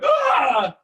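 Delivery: high, loud startled yelp; startled lion yelp. Retold or imitated. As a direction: yelp like a spooked young lion. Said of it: "just kinda going".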